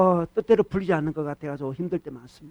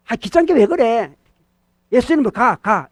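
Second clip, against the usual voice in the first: raised, exasperated command; acted irritation, higher and louder; annoyed rebuke; 4 semitones higher, 5 dB louder than usual. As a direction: shoo the devil away, fed up. Acted rebuke of pestering devil.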